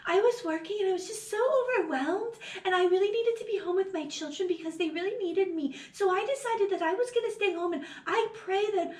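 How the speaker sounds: put-on soft little-girl wife voice; baby fundy voice. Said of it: "that's literally how she fucking sounds".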